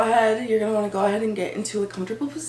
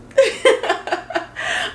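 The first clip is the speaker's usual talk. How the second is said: high, loud burst of giggles; real laugh; 12 semitones higher, 5 dB louder than usual. Real, not acted. Vlogger cracks up talking to viewers, real laugh. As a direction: burst out laughing mid-thought.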